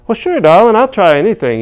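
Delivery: high genteel Southern lady; sweet Southern lady. Retold or imitated. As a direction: chirp it like a genteel elderly lady. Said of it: "old lady replies".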